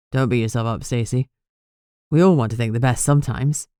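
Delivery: a hint of a caring boyfriend comforting her; Robbie's voice. A narrator's reading.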